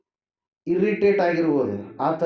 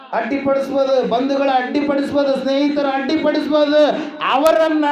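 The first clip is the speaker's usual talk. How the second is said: high, insistent sermon list; raised preacher's voice; fervent preaching; 8 semitones higher, 4 dB louder than usual. Acted. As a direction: hammer out each item to the congregation.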